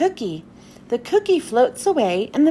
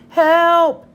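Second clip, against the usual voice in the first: higher, louder cry for help; yelling; 4 semitones higher, 6 dB louder than usual. Acted. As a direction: call out for rescue. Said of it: "yells Pete".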